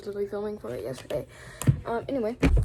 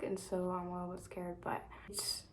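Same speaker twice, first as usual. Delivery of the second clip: whisper; 3 semitones lower, 11 dB quieter than usual. Real, not acted. Unvoiced aside about being scared.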